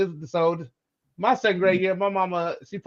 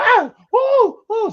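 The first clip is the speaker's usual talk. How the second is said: high loud howling yelps under the belt; mock pained yelps; 7 dB louder, much slower than usual. Boy howling as the stepfather whips him with a belt. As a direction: cry out high like a kid getting whipped. Being whipped in bathroom.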